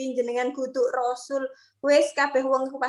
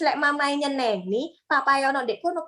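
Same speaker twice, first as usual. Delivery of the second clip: ordinary talk, nothing marked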